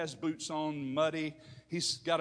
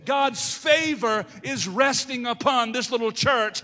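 loud, raised pulpit proclamation; full-force preaching; 9 semitones higher, 12 dB louder than usual. Real, not acted.

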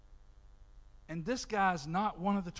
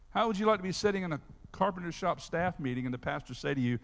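ordinary talk, nothing marked

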